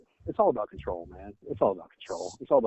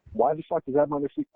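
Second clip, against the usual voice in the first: normal talking voice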